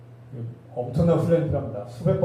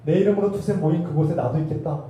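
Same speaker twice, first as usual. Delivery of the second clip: ordinary delivery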